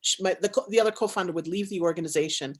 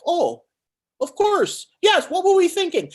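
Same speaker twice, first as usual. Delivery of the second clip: fight or flight squeal; 9 semitones higher, 6 dB louder than usual. Retold or imitated. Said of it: "they were like".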